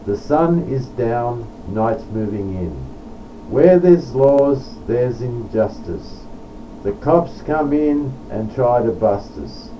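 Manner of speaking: declaimed verse, each line opening strong then falling away; poem recital. Acted. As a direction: recite it like a plea, punching the first words. Reads own poem aloud.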